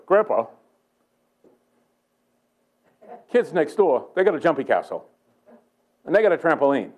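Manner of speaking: a hint of a child pleading with grandpa; young grandson. Eight-year-old telling grandpa about the neighbors' trampoline, lightly imitated child. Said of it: "he comes out and he goes".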